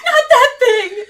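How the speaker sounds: terrified sobbing. Acted. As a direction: plead in a panicked, tearful scream. Terrified woman fears the creature.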